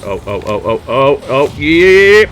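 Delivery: rising ohs into a loud high yeah; mounting excitement. Real, not acted. Player watching a chance come off, building excitement.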